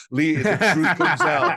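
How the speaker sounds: laughing, high and loud gotcha; laughing tease. Real, not acted. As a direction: tease him through a laugh. Teasing guest, laughing.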